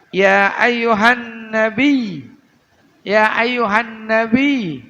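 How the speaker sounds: Ya ayyuhan nabi, slow, the last ya of nabi pressed and wavered; end pressed. Shown as a demonstration. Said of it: "ujungnya digoyang, ditekan dikit". (ujung "nabi" digoyang dan ditekan sedikit)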